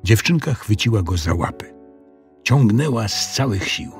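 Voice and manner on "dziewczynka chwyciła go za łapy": ordinary delivery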